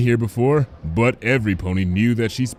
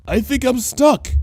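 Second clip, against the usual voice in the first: higher, calm and mild; unbothered; 4 dB louder than usual. A narrator's reading. Lyra stuck in the window, unbothered. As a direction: say it like it's nothing. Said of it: "with far less panic than the situation merited".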